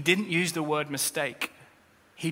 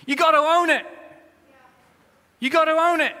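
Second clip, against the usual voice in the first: loud, high preaching cry; preaching shout; 11 semitones higher, 8 dB louder than usual. Real, not acted. Preacher urging the church to own their sin, shouting. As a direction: call it out like a revival preacher.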